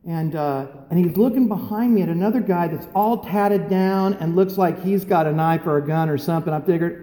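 ordinary talk, nothing marked

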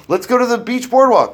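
high, loud and bright, selling the idea; eager suggestion. Retold or imitated. Dad eagerly suggesting bowling to the family, high and loud. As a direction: pitch it like a fun family outing.

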